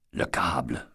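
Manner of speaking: low breathless whisper of the word; hushed realisation, all breath; whispered mutter. Acted. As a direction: breathe it to yourself in dread. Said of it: "marmonna Eddie".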